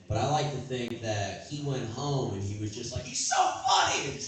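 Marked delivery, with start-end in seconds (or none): 3.0-4.1 high, loud gushing cry; excited shout. Retold or imitated. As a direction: shout it out like a starstruck fan. Imagined ex shouting praise.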